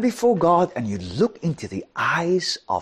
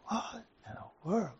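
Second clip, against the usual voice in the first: slow, hushed whisper of disbelief; whispered astonishment; 3 semitones higher, 15 dB quieter, slower than usual. Retold or imitated. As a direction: mouth it as a private aside. Retold inner astonishment at dinner.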